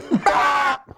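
high-pitched burst of laughter; real laugh. Real, not acted. Cracking up at own Seinfeld comparison, high laugh.

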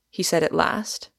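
ordinary delivery, nothing marked

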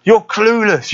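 lifted, defiant taunt; [name] taunting. Retold or imitated. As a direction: snap it back up high, scornful. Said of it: "[name] is looking back at these events and saying".

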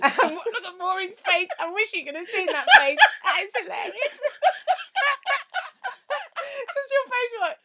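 cracking up at a face; laughing. Real, not acted.

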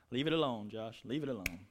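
low, quiet word to himself; self-restraining aside. Real, not acted. Preacher telling himself to drop it, low muttered aside. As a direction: drop low and soft to hold yourself back.

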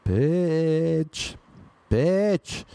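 restrained mock yell across a restaurant; imitated shouting. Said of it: "she just starts shouting across the restaurant".

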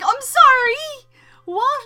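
high, loud incredulous yelp; stunned disbelief. Retold or imitated. Retelling the moment a friend broke the news, high shocked disbelief. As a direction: act out the shock of hearing it.

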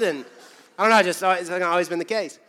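ordinary delivery

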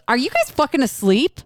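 high, exasperated question rising at the end; mock scolding. Retelling how the host scolded the audience, exasperated scolding. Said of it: "Like, scolded us".

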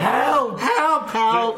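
high, loud cries for help; mock yelling. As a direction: yell like a tiny trapped game character. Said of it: "And she starts yelling".

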